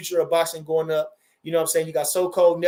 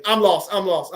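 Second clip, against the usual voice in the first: ordinary talk, nothing marked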